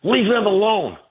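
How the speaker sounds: high pitched imitation of her snapping back; mock protester. Speaker imitates protester defending Iran, pitched high. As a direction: mimic her indignant retort. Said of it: "she turns around and says".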